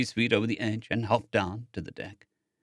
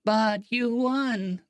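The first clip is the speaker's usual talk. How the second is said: insisting; 11 semitones higher, much slower than usual. A narrator's reading. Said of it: "Vi insisted".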